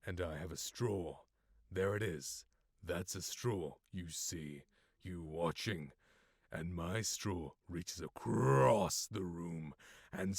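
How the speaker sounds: very quiet, slow, menacing murmur; acting monologue, hushed. Acting test on Eli the boy, hushed menace. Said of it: "then I will do an acting test".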